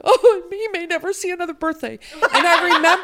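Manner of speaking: high laughing mock despair. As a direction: laugh through the tragic line. Mother retells despair, laughing.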